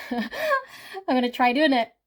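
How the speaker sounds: giggling, fighting a grin; laughing through the words; trying not to smile. Real, not acted. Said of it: "I can't stop smiling".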